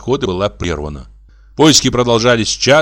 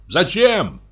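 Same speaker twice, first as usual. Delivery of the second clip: calling back; 9 semitones higher than usual. A narrator's reading. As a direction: call the question across a distance. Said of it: "крикнул Егор Егорыч". (высокий окрик издалека)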